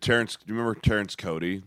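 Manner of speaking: usual speaking voice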